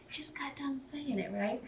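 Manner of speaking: unvoiced aside, half to herself; whisper. Real, not acted. A cook whispering an aside to the camera, hushed. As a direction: whisper it under your breath.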